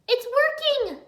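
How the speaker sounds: high, loud thrilled cry; excited exclamation. Acted. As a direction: announce the success with glee. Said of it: "Irene exclaimed".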